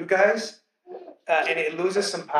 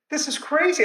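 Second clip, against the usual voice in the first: a much higher, delighted burst of wonder; amazed exclamation; 9 semitones higher than usual. Retold or imitated. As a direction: let the amazement jump up in pitch. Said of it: "I was like".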